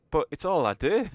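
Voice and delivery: indignant in-character plea; acted protest. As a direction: protest your one and only talent. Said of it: "Pulls out all his guns, like".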